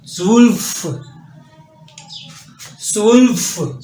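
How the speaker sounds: स्वुल्फ with an s start, rounded vowel; German zwölf. Shown as a demonstration. Teacher models German twelve, slow. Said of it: "twelve को पढ़ेंगे स्वुल्फ".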